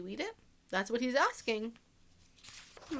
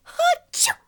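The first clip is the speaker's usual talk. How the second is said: a sudden sharp achoo, pitched way up; high loud mock sneeze; 12 dB louder than usual. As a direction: fake a big sneeze. Wolf sneezes inside the story.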